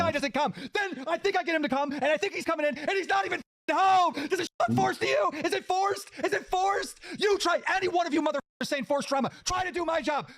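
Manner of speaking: high, strained, indignant challenge; furious rant. Real, not acted. Host daring accusers to do his job, furious rant. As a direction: throw the accusation back in anger.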